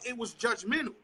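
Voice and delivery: ordinary talk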